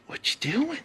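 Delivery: a tiny high, soft "What you doing?" for the cat; high soft coo. Real, not acted. Repeating it higher and softer.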